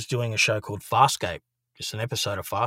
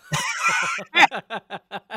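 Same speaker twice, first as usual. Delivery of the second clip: real laugh; 13 semitones higher, 5 dB louder than usual. Real, not acted. Laughing at the memory.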